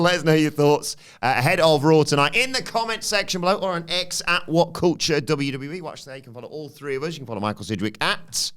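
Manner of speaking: high, upbeat announcer plug; podcast outro. Acted. Host plugging the channel to listeners, upbeat announcer read. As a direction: sell the channel brightly.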